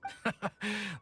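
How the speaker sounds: brief knowing chuckle. Real, not acted. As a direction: chuckle in agreement. Guard laughs agreeing about Jean.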